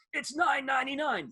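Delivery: high, punchy announcer hype; obnoxious ad voice. Retold or imitated. Radio host mimics the record-store ad announcer, obnoxious. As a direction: bark the price like a pushy ad man. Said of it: "this obnoxious voice goes".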